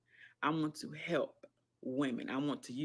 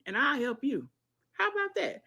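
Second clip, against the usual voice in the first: high, bright, teasing offer; a sudden high squeal on the question; excited; 10 semitones higher than usual. Real, not acted. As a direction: pitch it way up playfully. Playful offer to viewers.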